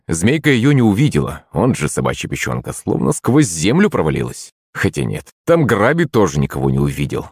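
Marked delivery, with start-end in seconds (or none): none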